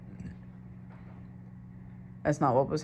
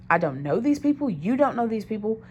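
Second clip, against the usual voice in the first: retold objection, higher and more emphatic; insistent protest; 7 semitones higher, 4 dB louder than usual. Uncomfortable girlfriend objecting to boyfriend, insistent. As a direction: press the point in exasperation. Retells protesting about unknown people.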